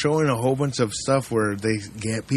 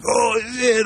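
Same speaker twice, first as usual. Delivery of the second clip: panicked outburst; 9 semitones higher than usual. Pretend. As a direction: blurt it like you've just been caught. Imagining himself after a crime.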